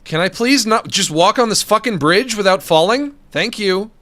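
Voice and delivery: loud high exasperated yell; frustrated yelling. Real, not acted. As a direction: yell it at the game. Frustrated at falling off.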